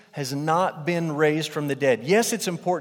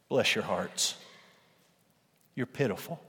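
mock pity; 4 semitones lower, 6 dB quieter than usual. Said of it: "what Paul is saying".

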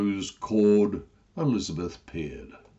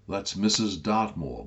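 plain reading voice

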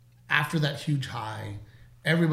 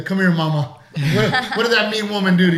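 put-on high, loud cooing baby talk; mock doting dad; 6 semitones higher, 9 dB louder than usual. Dad comforting his little girl, loud mock coo. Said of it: "I'm like".

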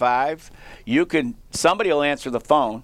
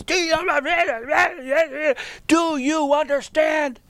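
in-his-face confrontation; 13 semitones higher than usual. Retold or imitated. Rookie coach confronting the player who challenged him, in his face. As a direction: get right up in his face, drawing it out. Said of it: "I'm right up in his face".

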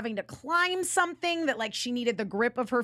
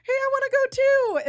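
put-on much higher, louder little-kid voice; childish plea; 11 semitones higher, 6 dB louder, faster than usual. Chibiusa begging the Senshi to come along, high childish voice. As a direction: pipe up like an eager child.

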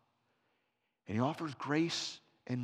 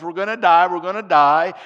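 loud panicked cry of doomed passengers; mock scream; 4 semitones higher, 15 dB louder than usual. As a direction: yell it like the plane is going down. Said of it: "screaming at the top of their lungs".

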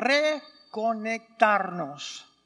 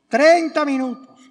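mock shock; 4 semitones higher, 10 dB louder than usual. Real, not acted. Self-mocking about length.

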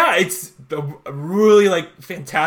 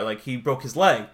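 ordinary delivery

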